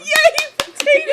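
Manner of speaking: high, loud laughing cheer; excited cheer. Real, not acted. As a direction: squeal it with delight.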